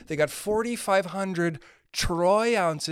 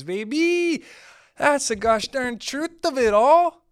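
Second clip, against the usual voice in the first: hammed-up declaration; 9 semitones higher, 6 dB louder, slower than usual. Hammed-up punchline about the truth.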